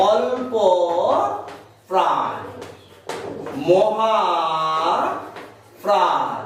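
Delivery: each word spaced out for writing; slow dictation. Shown as a demonstration. Teacher dictating to pupils, slow and spaced. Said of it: "আমরা লিখবো একটা করে শব্দ লিখবো".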